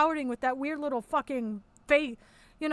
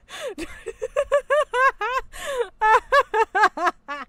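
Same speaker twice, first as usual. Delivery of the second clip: high, loud wheezing laugh; high laughing fit; long laughing fit; 8 semitones higher, 4 dB louder than usual. Real, not acted.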